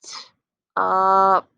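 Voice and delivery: slow blending sounds; slow sliding sounds. Shown as a demonstration. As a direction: slide through each sound, held long. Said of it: "Try it again".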